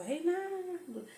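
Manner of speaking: high, drawn-out shout for Reinaldo; calling out. Retold or imitated. Mother calls her son to the phone, high drawn-out shout. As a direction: call his name across the house. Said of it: "gritando, gritando".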